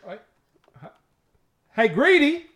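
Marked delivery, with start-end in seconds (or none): 1.7-2.5 high, loud call for attention. Real, not acted. Host calling the friend who missed the question, loud high holler. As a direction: holler it to snap them awake.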